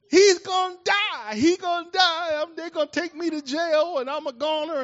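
high, loud, wailing mock panic; hammed-up panicked shout, very high; terrified boy. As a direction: cry out like a kid sure he's doomed. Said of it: "I was scared out of my".

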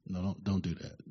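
a hushed, deep warning from God; God's voice. Retold or imitated. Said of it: "God says".

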